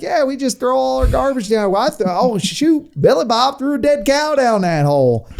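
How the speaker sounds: high-pitched hammed-up Southern yokel; mock Southern twang; Southern accent. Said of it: "why they have Southern accents".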